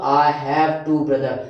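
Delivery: slow, clear, every word apart; slow model sentence. Shown as a demonstration. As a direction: dictate it slowly. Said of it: "तो यहाँ मैं क्या करूँगा?". (धीमे, साफ़ शब्दों में)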